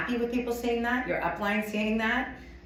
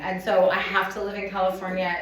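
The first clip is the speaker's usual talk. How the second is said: ordinary talk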